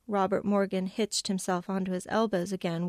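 usual speaking voice

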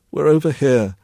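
voice clotted with crying. A narrator's reading. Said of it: "her voice clotted with crying".

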